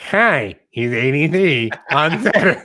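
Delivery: forecast breaking into laughter; laughing robot voice. Shown as a demonstration. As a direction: try the robot read, crack up. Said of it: "more through the nose and a little higher pitched".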